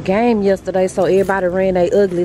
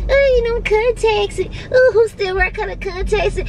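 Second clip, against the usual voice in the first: mocking snooty people; 13 semitones higher than usual. Vlogger mocking people who judge colored contacts, high snooty sneer. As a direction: sneer it like a judgy girl. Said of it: "people do be like".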